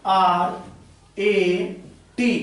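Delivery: आर, ए, टी as slow separate letters; spelling out. Shown as a demonstration. Teacher spelling for the class, slow and separated. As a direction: spell आर ए टी with a gap after each. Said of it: "जैसे आप बोले".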